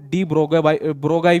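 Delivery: "de Broglie" said as dee bro-GUY; alternative pronunciation. Shown as a demonstration. Teacher showing students how some people say it, alternative pronunciation "bro-guy". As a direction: end "Broglie" in a "guy" sound, like "ब्रोगाई". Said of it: "कुछ लोग इसको".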